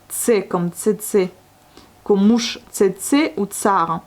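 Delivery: tsetse said very slowly with a crisp ts at the start; slow sound demo. Shown as a demonstration. Teacher slowly modelling the ts sound. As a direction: draw out tsetse and tsar, stressing the ts. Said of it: "La lettre C".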